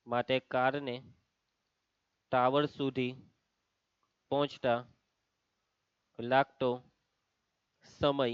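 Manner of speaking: soft, low, phrase-by-phrase dictation. Real, not acted. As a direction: dictate softly in small chunks with long pauses. Dictating answer as class writes.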